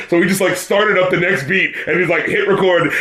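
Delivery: usual speaking voice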